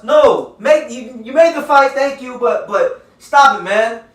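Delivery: exasperated scolding. Real, not acted. Scolding the negotiators.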